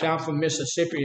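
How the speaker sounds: ordinary talk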